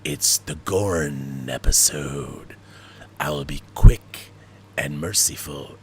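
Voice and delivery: slow low Gorn impression. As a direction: rumble it like a lumbering reptile alien. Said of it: "episode called Arena".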